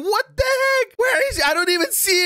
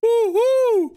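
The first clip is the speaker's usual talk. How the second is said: high, hollow double hoot; screamed owl hoot; 7 semitones higher than usual. Pretend. Prankster swoops at his friend to scare him, high owl hooting. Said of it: "while screaming, "Ooh, ooh".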